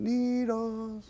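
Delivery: long, high drawn-out call; stretched-out searching holler. Acted. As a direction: call into the woods for a lost pet. Grandfather searching woods, calling porcupine's name.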